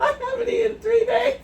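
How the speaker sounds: high imitation through laughter; laughing, high-pitched mock whine; mock starving complaint. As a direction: mimic a famished friend while cracking up. Mocking friend's hungry eating.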